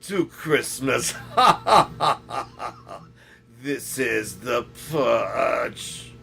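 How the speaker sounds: loud, very drawn-out villain proclamation with evil laughs; mock villain announcer. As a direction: announce doom slowly like a hammed-up villain.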